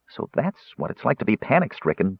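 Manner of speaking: ordinary delivery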